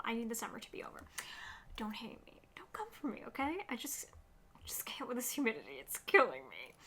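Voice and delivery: whispery, playful plea for understanding; hushed confession. Real, not acted. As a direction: confide it to viewers under your breath. Hushed confession to viewers about summer.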